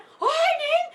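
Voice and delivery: very high, joyful calling out; thrilled cry that her arm moves; excited cry. Retold or imitated. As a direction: shout the good news to the girl, way up high. Arm suddenly lifts again.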